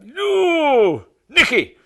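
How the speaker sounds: squeaky high voice, loud and thrilled; high excited manager. Retold or imitated. Manager phoning with big news, squeaky and loud. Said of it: "he phones up".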